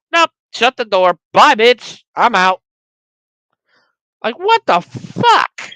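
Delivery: loud, high mock of the character; nope, bye, I'm out; loud mocking rant. Real, not acted. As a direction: spit it out in disgust. Mocking Bianca, then outraged.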